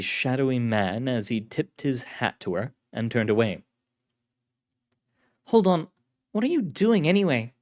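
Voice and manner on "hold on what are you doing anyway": female agent voice. Acted. Carlotta voiced.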